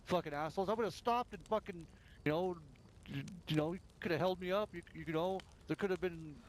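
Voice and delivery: high, heated accusing rant; retold furious accusation. Player reenacting accusing rivals of shooting him, high heated rant. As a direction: tear into them for the ambush. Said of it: "then I'm like".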